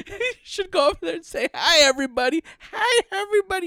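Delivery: put-on sweet old aunt. Retelling aunt's advice.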